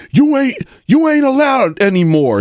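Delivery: high, squeaky mock whine; squeaky outraged impression of the host; mocking Rosenberg. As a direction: whine it like a sulky kid. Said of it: "he was like".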